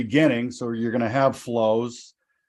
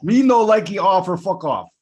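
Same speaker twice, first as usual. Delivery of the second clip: loud, high, badly faked Japanese accent; terrible Japanese accent; 6 semitones higher, 5 dB louder than usual. Host repeating the golfer's parody quote, exaggerated fake Japanese accent. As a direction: ham up a bad Japanese accent. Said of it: "terrible Japanese accent, by the way".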